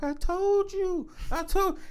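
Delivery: slow, high, soft mimicry; imitated woman. Man mimicking a partner's I-told-you-so, imitated woman. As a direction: stretch it out high, imitating her.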